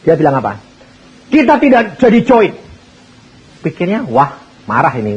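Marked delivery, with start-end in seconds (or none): 1.3-2.5 firm refusal. Acted. Young man cancels the deal.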